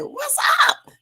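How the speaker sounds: high, parrot-like what's up; playful high bird mimicry; parrot imitation. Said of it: "And then the bird said".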